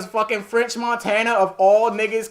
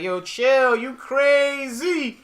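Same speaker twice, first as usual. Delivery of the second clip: mock tough guy; 5 semitones higher, much slower than usual. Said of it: "and he like".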